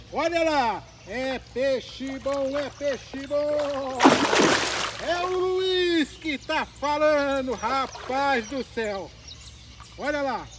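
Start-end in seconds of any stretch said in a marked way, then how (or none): 1.1-9.1 slow, stretched-out celebratory call; drawn-out catchphrase. Real, not acted. Angler hyping the fish for viewers, drawn-out call. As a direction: hold the vowels long, like a commentator's call.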